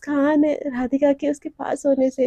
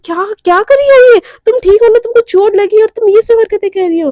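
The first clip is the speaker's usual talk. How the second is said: high, loud and alarmed; loud worried scolding; 6 semitones higher, 9 dB louder than usual. Acted. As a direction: shout at her, half scared, half furious. Girlfriend scolds after fake fall. (घबराई हुई ऊँची आवाज़ में डाँटना)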